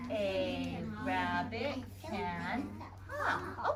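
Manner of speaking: "A rabbit can hop" very slow and soft, one word at a time; very slow word count. Shown as a demonstration. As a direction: count the words out slowly. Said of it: "How many words?".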